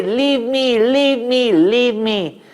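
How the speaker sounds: high and loud, desperate begging; the demoness shrieking to be released; screaming plea. Acted. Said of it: "she was screaming away".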